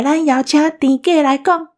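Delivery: usual speaking voice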